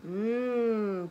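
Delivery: long, drawn-out yummy hum; savoring "mmm". Acted. Storyteller as baby licking his lips, savoring hum. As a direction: hum as if tasting a treat.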